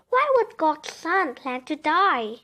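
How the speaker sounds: ordinary delivery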